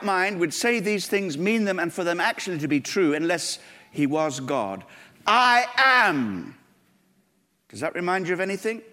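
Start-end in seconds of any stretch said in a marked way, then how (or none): 5.3-6.6 higher and louder declaration; "I am", lifted and ringing; solemn divine claim. Acted. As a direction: proclaim it as God would. Quoting Jesus' divine claim.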